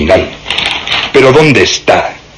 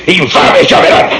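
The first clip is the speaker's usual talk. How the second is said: hissed curse; 9 semitones higher than usual. Acted. Inspector cursing the journalist.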